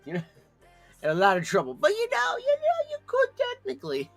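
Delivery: very high, loud teasing squeak; squeaky mock voice. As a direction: squeak it out like a cheeky tempter. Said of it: "I was like".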